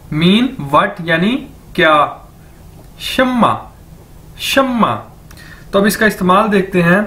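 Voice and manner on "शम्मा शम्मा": "शम्मा" pitched high, dropping on ma; model pronunciation. Shown as a demonstration. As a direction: drill "शम्मा" as a higher shum-ma with held m. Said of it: "इसको कैसे बोलेंगे".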